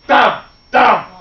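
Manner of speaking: loud, punched, separate thumps; heavy footsteps. A narrator's reading. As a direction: stamp out each thump like a giant's step. Imitating giant footsteps.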